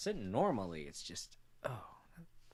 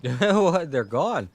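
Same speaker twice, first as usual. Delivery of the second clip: surprised; 7 semitones higher, 11 dB louder, much slower than usual. Real, not acted. Characters suddenly vanish on screen.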